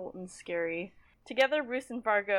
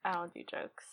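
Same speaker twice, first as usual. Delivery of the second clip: Roose Bolton voice; 7 dB quieter than usual. Retold or imitated. Lord answering a wisecrack, soft menacing reply. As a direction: drop it quiet and dead serious. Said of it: "Roose is like".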